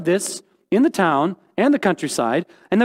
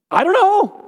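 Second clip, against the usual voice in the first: squeaky comic "I don't know"; 11 semitones higher than usual. Pretend. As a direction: squeak it out like a baffled shrug. Comic refrain answering hard questions.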